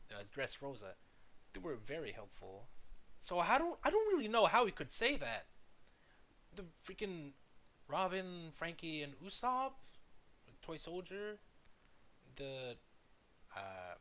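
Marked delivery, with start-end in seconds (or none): 3.2-11.4 high, loud disbelief; worked up and baffled; exasperated. Real, not acted. Reacting to the clip's claim.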